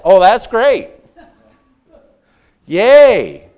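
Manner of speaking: ordinary delivery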